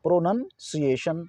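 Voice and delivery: "प्रोनाउंसिएशन" slowly, with a short 'nun'; slow correct pronunciation. Shown as a demonstration. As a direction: break "प्रोनाउंसिएशन" into pro-nun, see-ay-shun. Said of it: "देखिए यहाँ पर लिखा है". ("प्रोनाउंसिएशन" धीरे, 'प्रोनन-सिएशन' बोलें)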